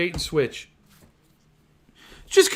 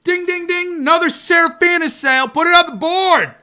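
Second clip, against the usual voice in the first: much higher, louder crowing; mock sales celebration; 13 semitones higher, 7 dB louder than usual. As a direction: crow over the sale like a salesman.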